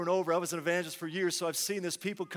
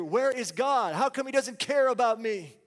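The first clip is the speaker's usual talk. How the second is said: raised, louder anxious outcry; fearful doubting; 4 semitones higher, 4 dB louder than usual. Acted. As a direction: cry out to God as if abandoned. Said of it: "Would you just be consumed in fear".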